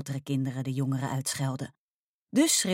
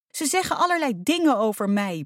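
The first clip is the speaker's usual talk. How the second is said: raised, higher-pitched voice; upset yell; 7 semitones higher, 4 dB louder than usual. A narrator's reading. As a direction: cry it out in indignation. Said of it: "brulde ze". (luid en verontwaardigd uitroepen)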